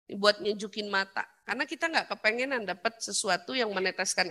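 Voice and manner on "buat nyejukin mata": usual speaking voice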